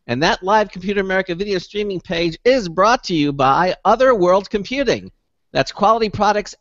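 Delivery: measured sponsor read with punched key words; radio ad read. Real, not acted. Host promoting computer sponsor to listeners, measured ad-read delivery.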